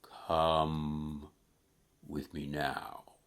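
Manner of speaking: low, soft, drawn-out spirit voice; solemn ghost. Acted. New ghost summons Scrooge from his bed, low and solemn. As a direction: beckon in a low, hushed voice. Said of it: "these words it spoke".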